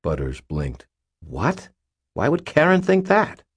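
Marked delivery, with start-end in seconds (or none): 1.2-3.4 high-pitched incredulous question; taken aback. A narrator's reading. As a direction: pitch leaps up in bewilderment. Butters taken aback by Daniel's claim.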